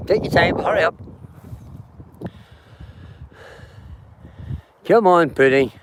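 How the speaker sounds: calling out. Real, not acted. Calling the horses along.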